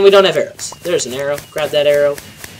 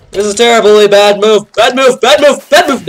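high, loud alarmed yelling; panicked shout; 5 semitones higher, 7 dB louder than usual. Real, not acted. Gamer yells in alarm as the attack fails.

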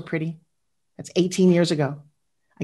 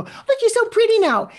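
high, gushing compliment in a much higher voice; mock gushing compliment; 13 semitones higher than usual. She mimics people now fawning over her looks, mock gushing and much higher. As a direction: squeal it like a fawning admirer.

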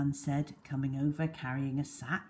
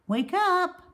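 calling to wake him; 6 dB louder than usual. Acted. Nurse rousing injured boy.

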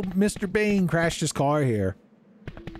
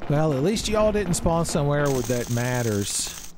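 ordinary talk, nothing marked

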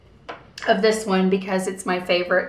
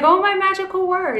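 gushing excitement; 10 semitones higher than usual. Retold or imitated. Gushing about Disney to clerk.

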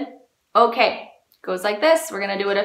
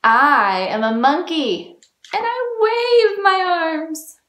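loud, climbing high with excitement; excited monkey; 6 semitones higher, 7 dB louder than usual. Acted. Teacher reading the monkey page to children, loud and excited. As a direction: read it big and high for children.

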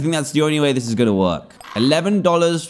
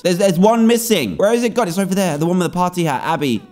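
usual speaking voice

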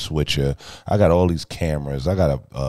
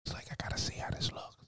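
hushed, fast aside; whispering; 18 dB quieter, faster than usual. Real, not acted. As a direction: whisper it under your breath.

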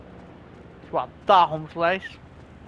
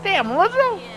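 mock astonishment; 7 semitones higher than usual. Gamer joking about who turned up in play, mock astonishment. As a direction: exclaim as if scandalized, only kidding. Mock surprise at game characters, then kidding.

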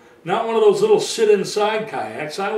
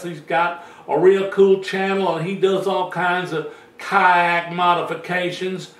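put-on Southern US accent. Vlogger imitating a kayak channel host, Southern accent. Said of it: "some guy down south, and he kinda talks like this".

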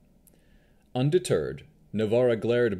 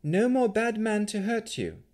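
put-on high young woman voice; 13 semitones higher than usual. Navarra voiced.

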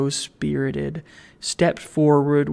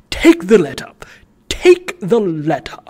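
louder, curt brush-off; impatient dismissal; 3 semitones higher, 6 dB louder than usual. Acted. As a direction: wave him away impatiently.

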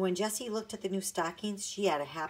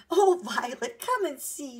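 much higher, far louder call; 11 semitones higher, 10 dB louder than usual. A narrator's reading. Jessie calling Violet to come see the dog. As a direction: call her sister over across the camp. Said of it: "she called".

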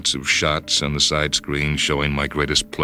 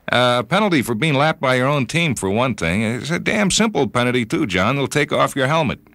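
put-on higher voice of a blunt old friend; old buddy Cletus; 9 semitones higher than usual. Judge friend explaining new rules to John, higher voice. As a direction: lay out the new rule with a shrug. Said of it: "he tells me they're considering some changes".

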